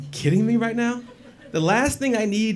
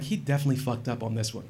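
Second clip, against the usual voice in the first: smug aside; 5 semitones lower, 7 dB quieter, faster than usual. Retold or imitated. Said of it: "But I'm like".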